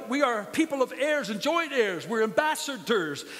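rousing preacher proclaiming to the church; preaching at full force. Acted. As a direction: build it up like a pulpit climax. Preaching climax.

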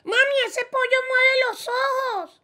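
put-on child voice. Child calling mother.

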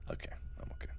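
barely audible whisper after an outburst; whispered self-calming. Real, not acted. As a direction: whisper to pull yourself together.

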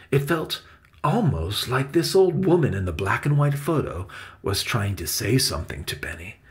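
ordinary delivery, nothing marked

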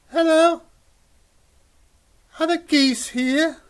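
put-on high, loud baby-goose voice, asking eagerly; high little gosling. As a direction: call out like a tiny lost gosling. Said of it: "and he says".

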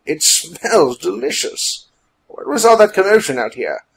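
high, loud, relishing character voice; jaunty William. A narrator's reading. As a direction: savour it, then call out the question.

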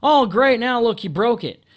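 sarcastic, exasperated complaint; retold annoyance. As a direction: coworker broke a glass at his feet, annoyed outburst. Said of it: "And I said".

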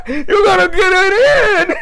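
high loud words through laughter; high, loud laughing shout; laughing yell. Real, not acted. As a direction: shout it while cracking up. Yelled while laughing.

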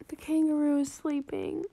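usual speaking voice

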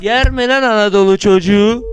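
loud boastful yell; triumphant shout. Real, not acted. Gamer taunting the beaten level, loud triumphant yell. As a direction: crow over the win at full volume. Triumphant after beating obstacle.

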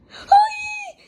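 very high shrieking hello; high squeaky greeting shouted at the owner; excited shout. Acted.

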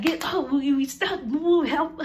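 hammed-up stuck kid yelling for help; mock frantic cry. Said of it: "So we was like".

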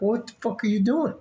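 mock-outraged disbelief at dressed-up shoppers; exasperated rant. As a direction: rant at overdressed supermarket shoppers. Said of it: "You're like".

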